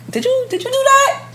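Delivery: high-pitched suspicious question; high suspicious ask. Retold or imitated. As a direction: ask it high like you already know. Testing partner, high suspicious ask.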